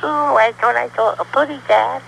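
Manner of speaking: put-on high, lisping baby bird voice; Tweety. Shown as a demonstration. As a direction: squeak it out like a tiny baby bird. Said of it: "I had to give him a baby voice".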